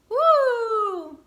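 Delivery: excited woo. Real, not acted. Vlogger celebrates her own trick with a loud excited whoop. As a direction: cheer out loud.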